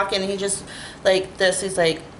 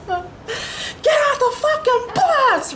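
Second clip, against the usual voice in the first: passenger yelling; much slower than usual. Retold or imitated. Angry passenger ordering her off, imitated yell. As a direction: bellow it high and drawn out. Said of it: "started yelling and screaming".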